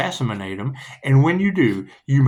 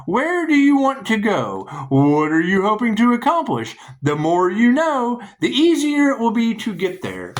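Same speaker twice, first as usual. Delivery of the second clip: emphatic, higher read-out delivery; card reading; 9 semitones higher, 3 dB louder than usual. Acted. Reader delivers the card's message to the listener, emphatic read-out. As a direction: announce each question with lifted pitch.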